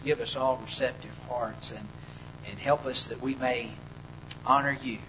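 soft, low closing prayer before the sermon; quiet prayer. Real, not acted. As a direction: pray it quietly and reverently. Opening prayer, hushed.

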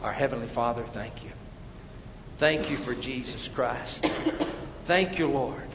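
low, reverent prayer with pauses. Real, not acted. Preacher thanks Heavenly Father for Jesus, reverent prayer. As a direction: give thanks to God quietly.

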